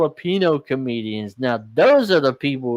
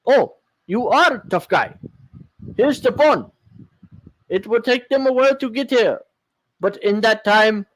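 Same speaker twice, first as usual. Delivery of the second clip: taunting, higher and quick; mocking high voice; 7 semitones higher than usual. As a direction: sneer it back, pitched up. Acted mocking tough reply.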